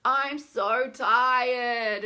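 loud, very drawn-out groaning complaint; exaggerated weary whine; whiny, fed up. Shown as a demonstration. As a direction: moan it out, stretching "tired". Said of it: "get into this like".

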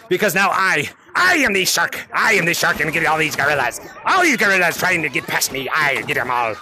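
very low playful menace; villainous shark. Pretend. Shark player threatening gorillas trying to pass, low villain voice. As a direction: drop deep as the hunting shark.

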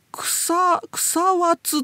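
草和津 slow and high, syllable by syllable; old spelling variant. Shown as a demonstration. Host reads out old record spellings, 草和津 slow and high. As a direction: read 草和津 out slowly as kusa-wa-tsu. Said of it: "っていう風にみんな書いてんのよ".

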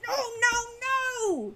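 very high, wailing creature shriek; shrieking kobold. A narrator's reading. Captive kobold contradicting Hawk, shrieking. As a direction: shriek a long protest. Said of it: "shrieked their captive".

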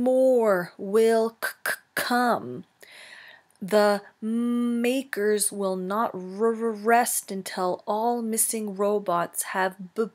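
slow, broken, stuttering robot voice; garbled robot voice. Acted. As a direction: glitch out like a dying robot. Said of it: "spoke in a quiet, garbled voice".

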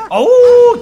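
sudden high "Au!" with a laugh; laughing yelp of shock; high startled yelp. Real, not acted. Startled in game.

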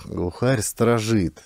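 usual speaking voice

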